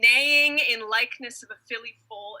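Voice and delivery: normal talking voice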